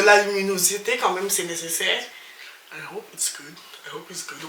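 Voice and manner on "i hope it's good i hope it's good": softer, muttered under her breath to herself; half to herself while fixing the lighting; quiet aside. Real, not acted. Vlogger fixing her lighting, muttering quietly to herself.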